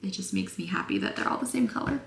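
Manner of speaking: ordinary delivery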